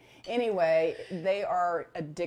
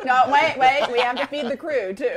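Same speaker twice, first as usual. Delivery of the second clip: laughing; 4 semitones higher, 10 dB louder than usual. Real, not acted. Laughing interjection, loud.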